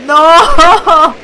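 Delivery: very loud, high cry of no; anguished shout. Real, not acted. Gamer yelling as his space goat dream falls apart. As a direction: scream in dismay.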